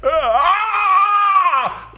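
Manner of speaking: long, high, loud mock scream; drawn-out full-voiced comic scream; imitated screaming. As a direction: escalate into a hammed-up wail. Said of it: "it kept getting worse".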